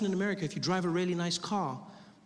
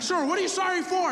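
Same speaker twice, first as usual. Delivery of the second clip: very high, baffled cop voice; freaked-out policeman; 12 semitones higher, 3 dB louder than usual. Retold or imitated. Officer questions man lying on the car, freaked out. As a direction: imitate a cop squeaking in alarm. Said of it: "just as freaked out as I was".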